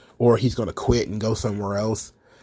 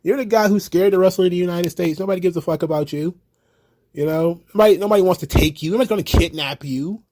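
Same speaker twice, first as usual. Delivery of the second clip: higher, mocking dismissive rant; scornful put-down; 7 semitones higher than usual. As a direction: brush him off with contempt. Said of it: "And it's like".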